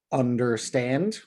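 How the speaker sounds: slow, stress on the last syllable of Understand; Understand, slowly, weight landing on -stand; anapest stress. Shown as a demonstration. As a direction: sound out Understand as da-da-DUM. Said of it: "as you can see there, the two unstressed syllables followed by the stressed syllable".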